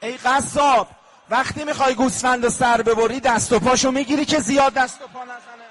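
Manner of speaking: rising lament to the butcher; lamenting address. Acted. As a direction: call out to the butcher in grief. Voicing questions to the butcher.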